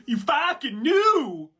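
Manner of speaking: high, slow squeaky yell; callback voice. Pretend. Repeating an earlier line in a high voice. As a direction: squeal it slowly. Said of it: "that was a callback".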